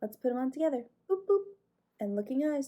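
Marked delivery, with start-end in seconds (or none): 1.1-1.4 high bright boops; playful boop sound effect. Pretend. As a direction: pretend switch-on noises. Putting on listening ears.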